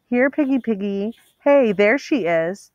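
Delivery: high sing-song taunt; taunting call. A narrator's reading. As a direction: call out to the hunted girl, mocking. Pursuers taunting up stairs.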